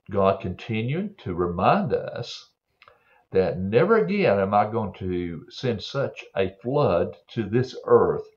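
usual speaking voice

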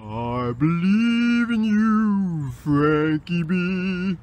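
slow, drawn-out divine pronouncement; voice of God. Acted. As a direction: intone it slowly like the Lord above. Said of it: "the Lord thy God".

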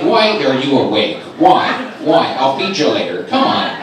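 loud, half-serious complaining; mock exasperation. Man complaining to his own body on waking, mock exasperation. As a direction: plead loudly, as if fed up.

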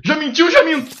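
high, loud exclamation; shouted punchline. Real, not acted. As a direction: blurt the silly joke at full voice.

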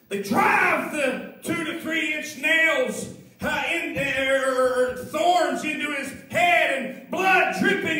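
loud, rising fervent preaching; preaching at full force. Acted. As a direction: drive it home to the congregation. Preacher builds crucifixion scene.

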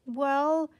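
ordinary delivery, nothing marked

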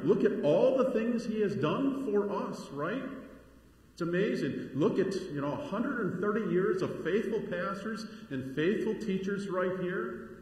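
high, driving sermon delivery; impassioned preaching. Real, not acted. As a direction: preach with mounting fervor, listing each blessing emphatically.

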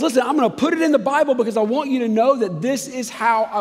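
God speaking up high and loud, earnest and urgent. Retold or imitated. Preacher voicing God to the congregation, high and loud. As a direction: lift the voice as God pleading to be heard. God voiced, louder and higher.

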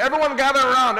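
loud market call to the crowd. Retold or imitated.

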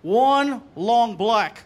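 very slow, stretched words; drawn-out order. Retold or imitated. Waiter repeats a coffee order, drawn out very slowly. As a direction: stretch each word out deliberately. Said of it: "he goes".

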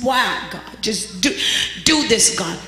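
low, soft laughing plea to God; mock weeping prayer. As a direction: mimic her tearful begging. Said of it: "She, she just".

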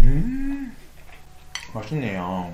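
chewing while talking; speaking between bites; mouth full. Real, not acted. Seen 4: Takes a bite at -1, cheeks full and chewing through 1.0.

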